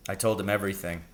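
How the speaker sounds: usual speaking voice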